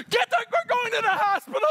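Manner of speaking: high, loud frantic shout; panicked shout. Retold or imitated. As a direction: yell in a panic.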